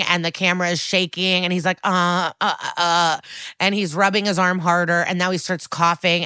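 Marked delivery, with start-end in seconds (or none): 1.8-3.2 stammering. Retold or imitated. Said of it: "stuttering and stammering".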